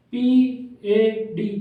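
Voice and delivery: teacher spelling the word aloud; letter by letter. Shown as a demonstration. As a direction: spell out पी ए डी one letter at a time. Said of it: "अब हम इसको कैसे read करेंगे?".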